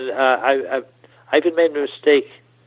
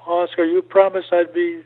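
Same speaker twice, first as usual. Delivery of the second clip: put-on high, slow tearful child's plea; crying like a little boy; 6 semitones higher than usual. Retelling how the puppet boy cried to Oscar, mock tearful and high. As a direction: whimper it like a small boy about to cry. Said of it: "he burst into tears like a little boy".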